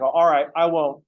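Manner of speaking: loud, playful fake compliance; loud teasing okay; mock agreeable promise. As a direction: promise loudly with a wink. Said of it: "I'm like".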